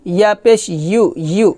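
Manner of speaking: ordinary talk, nothing marked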